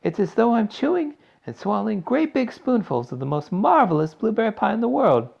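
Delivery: high-pitched, gushing delight; rapturous. A narrator's reading. Violet relishes the blueberry pie.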